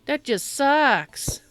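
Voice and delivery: whiny troll voice. Pretend. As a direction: whine it like a spoiled troll. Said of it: "I'm sounding like a whiny asshat on purpose".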